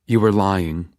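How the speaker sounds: usual speaking voice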